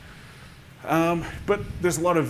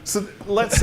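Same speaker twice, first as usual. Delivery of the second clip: laughing; 10 semitones higher, 9 dB louder, slower than usual. Real, not acted. Laughs after NDA slip.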